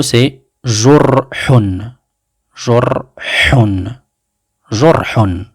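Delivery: jurhun drawn out very slowly, with a breathy h; teacher repeating jurhun for pupils; Arabic word demo. Shown as a demonstration. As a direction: model jurhun slowly for the class. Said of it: "Et le troisième mot".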